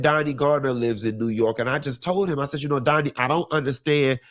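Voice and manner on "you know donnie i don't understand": ordinary talk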